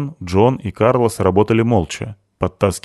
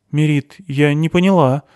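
mildly confused, higher pitch; bewildered; 8 semitones higher, 3 dB louder than usual. A narrator's reading. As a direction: say it a little at a loss. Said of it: "растерянно сказала Криспи". (слегка растерянно)

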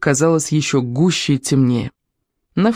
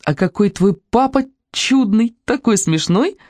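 bursting into laughter, higher and slow; laughing; 6 semitones higher, slower than usual. A narrator's reading. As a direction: giggle it out suddenly. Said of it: "рассмеялась она вдруг". (со смехом)

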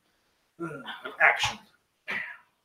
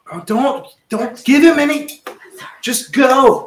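loud, rising in pitch as it builds; exasperated urging; 9 semitones higher, 7 dB louder, slower than usual. Real, not acted. Host stops others helping the player, loud exasperated urging. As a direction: cut in loudly, pushing them to hurry.

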